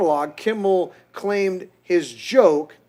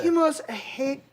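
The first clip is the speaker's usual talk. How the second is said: put-on higher, slow accented First Lady voice; high, drawn-out imitation of a foreign accent; mock Melania; 10 semitones higher, slower than usual. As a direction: try out a foreign-accented woman's voice, slowly. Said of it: "I don't wanna do her voice".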